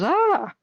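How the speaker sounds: a high, loud startled yelp; retold scream. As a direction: jump back and yelp. Friend screams as spider charges.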